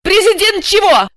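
high, loud incredulous question; demanding. A narrator's reading. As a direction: demand an answer sharply. Оскорблённая героиня требует ответа. (требовательно, громко и высоко)

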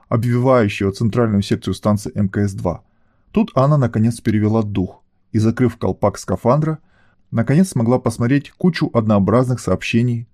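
plain reading voice

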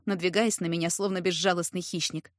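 ordinary delivery

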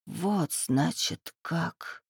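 very soft and slow, fading out; hushed, slow, bitter murmur; whisper through clenched teeth. A narrator's reading. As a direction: let it die away through gritted teeth. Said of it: "прошептала Ольга сквозь стиснутые зубы".

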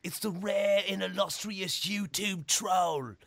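slow Australian wildlife-presenter accent, hammed up; ridiculous Australian accent. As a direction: present a rare beast in mock Australian accent. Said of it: "I'm doing this ridiculous accent".

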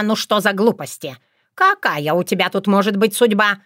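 usual speaking voice

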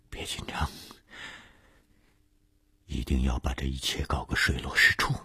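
very soft, breath-only whisper to oneself; whispered self-admonishment. A narrator's reading. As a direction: whisper it privately, like steeling your nerves. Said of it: "他告诫自己". (低声耳语，告诫自己)

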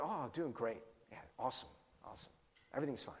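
soft, low, half-hearted reassurance; acting like everything's okay. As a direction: brush it off under your breath. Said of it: "act like everything's okay".

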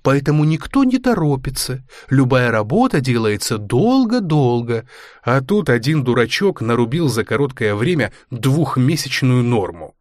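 ordinary delivery, nothing marked

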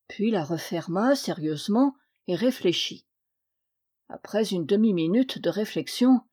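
plain reading voice

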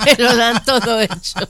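laughing it out, loud and high; words spilling through a big laugh. Real, not acted. Retiree laughing at her own idle life.

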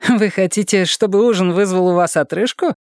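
high, smirking female voice. A narrator's reading. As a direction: tease him with a smirk. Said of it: "ухмыльнулась Эмили".

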